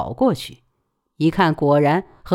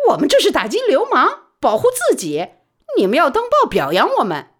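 high, fast and louder; proud boast; 10 semitones higher, 4 dB louder than usual. Acted. Baoli telling the police they deserve praise, high and fast. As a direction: brag to the police.

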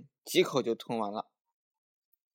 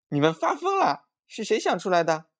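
very high, loud outburst; alarmed scolding cry; 8 semitones higher, 7 dB louder than usual. A narrator's reading. Mother finding the bottle empty, high-pitched scolding. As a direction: shriek it in alarm at the children. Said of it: "就叫了起来".

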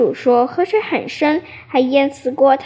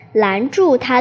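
usual speaking voice

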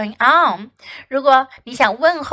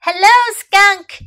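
higher, louder happy shout; calling out; 9 semitones higher, 7 dB louder than usual. A narrator's reading. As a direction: call across to Skunk. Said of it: "shouted Muslin".

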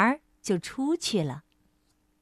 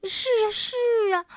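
high, quick, quavering mouse; trembling fright; 10 semitones higher, faster than usual. A narrator's reading. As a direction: shiver out a scared agreement. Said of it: "多多惊得直发抖".

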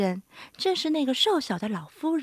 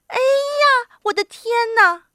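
high, loud startled exclamation; startled little old woman; frightened cry; 11 semitones higher, 7 dB louder than usual. A narrator's reading. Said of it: "瘦小的老妇人喊起来".

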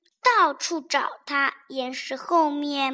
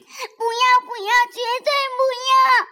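high loud fast pleading; little one begging not to part; desperate refusal; 10 semitones higher, 8 dB louder than usual. Acted. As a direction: cry no, no, never. Child refuses parting.

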